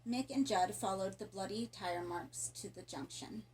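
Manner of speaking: plain reading voice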